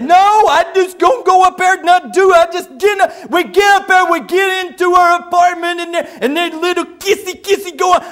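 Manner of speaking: hammed-up agitated shouting, words mangled; loud, high, tongue-tied protest; Buddy. Buddy explains himself to the preacher, worked up and loud. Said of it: "when he gets real worked up, he can't talk very good".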